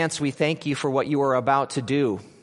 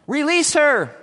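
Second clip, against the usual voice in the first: crowd calls; 10 semitones higher, 5 dB louder than usual. Acted.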